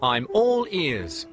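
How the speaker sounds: high, loud cartoon engine voice; a grumpy engine brushing it off; Ernest the engine car.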